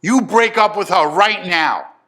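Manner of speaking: loud, high-pitched, slow order; angry father. Retold or imitated. Father orders son to end the relationship, angry shout. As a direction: lay down the law, drawing the words out.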